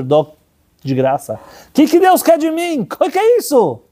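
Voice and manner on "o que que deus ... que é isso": stunned disbelief. Retold or imitated. Boy stunned by a lifetime of free hot dogs, amazed. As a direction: burst out in astonished wonder.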